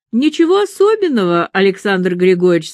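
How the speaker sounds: plain reading voice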